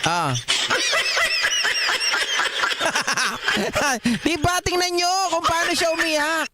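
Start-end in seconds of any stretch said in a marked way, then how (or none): 0.4-4.2 long high cackling laugh; high burst of laughter. Real, not acted. Streamer cracking up at the crying witness, high laugh. Big laugh at hearing clip.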